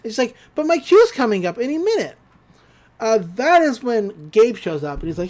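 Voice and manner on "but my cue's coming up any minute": high-pitched worried objection; lightly acted fretting; mock protest. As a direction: protest as if pressed for time. Mabel protests, retold.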